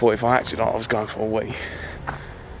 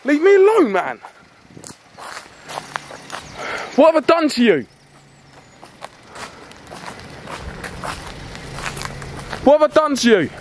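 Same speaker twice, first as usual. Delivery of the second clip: begging him to stop, near breaking; desperate screaming; 14 semitones higher than usual. Acted. Vlogger begging the armed driver to put it down, desperate screaming.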